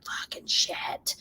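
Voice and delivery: slow, furious whisper; seething whisper. Retold or imitated. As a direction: whisper it slowly, as if about to blow. Retold seething, seeing red.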